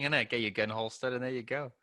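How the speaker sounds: ordinary delivery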